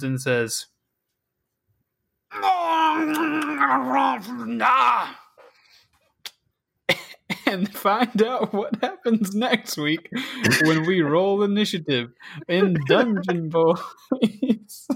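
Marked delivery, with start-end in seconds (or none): 2.3-5.1 high, shrieking creature cry; monster shriek. Acted. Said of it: "make that same noise again". 7.5-14.9 high, slow trailer announcer. Acted. Dramatic episode sign-off.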